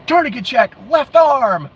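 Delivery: high, urgent mock drill call; high-pitched mock order; mock shouted command.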